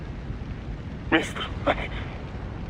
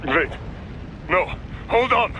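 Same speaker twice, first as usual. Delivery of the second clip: urgent cry; 3 dB louder than usual. Acted. Handler pleads with dying man.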